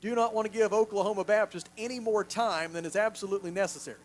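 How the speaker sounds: ordinary talk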